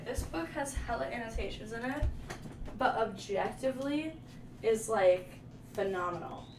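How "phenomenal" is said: usual speaking voice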